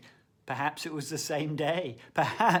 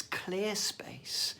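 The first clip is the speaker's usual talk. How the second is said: soft whispered aside; 3 semitones higher, 7 dB quieter than usual. Real, not acted. Speaker guiding listeners toward calm, whispered. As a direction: breathe the words out in a whisper. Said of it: "whispered aside".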